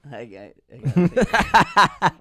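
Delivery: breaking into loud laughter; laughing. Real, not acted. Speller assures the others through giggles, laughing. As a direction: crack up while insisting. Speller cracking up mid-word.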